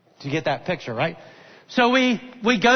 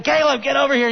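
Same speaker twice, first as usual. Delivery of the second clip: put-on higher, calling out firmly to a child; retold yell; 6 semitones higher, 3 dB louder, faster than usual. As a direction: call him back down the slope, sharp and firm. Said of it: "So I yell at him".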